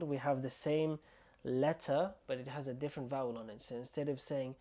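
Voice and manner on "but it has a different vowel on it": ordinary talk, nothing marked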